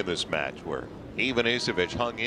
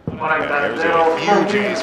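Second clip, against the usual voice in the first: loud, rising play-by-play shout; excited call; 7 semitones higher, 9 dB louder, much slower than usual. Real, not acted. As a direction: call out the ace loudly as it lands. Live call of an ace.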